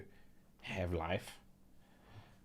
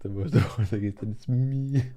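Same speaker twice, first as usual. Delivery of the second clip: put-on a Danish accent broken up by laughter, louder; Danish accent, laughing; 6 dB louder than usual. Repeating the accent bit for viewers, laughing through it. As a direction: try to hold the overexcited Dane but keep laughing. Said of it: "they sound like this".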